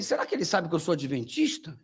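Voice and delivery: soft, low wondering to himself; inner thought. Retold or imitated. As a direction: think it aloud quietly.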